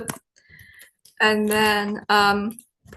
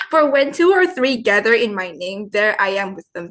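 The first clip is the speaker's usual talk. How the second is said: scripture reading; 2 semitones lower, 5 dB louder than usual. Acted. Reads a Bible verse.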